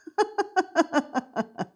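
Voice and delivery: high, rapid run of giggles. Real, not acted. Baker tickled by claiming she can do math, giggling. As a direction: giggle at your own little boast. Laughs at own math boast.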